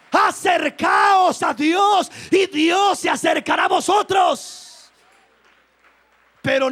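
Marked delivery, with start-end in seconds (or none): 0.1-4.6 loud, high, slow proclamation of the verse; preacher proclaiming the verse loudly; shouted scripture. Acted. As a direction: proclaim it to the whole church.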